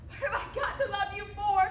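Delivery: passionate. Acted. Acted love confession.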